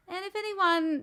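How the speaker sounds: normal talking voice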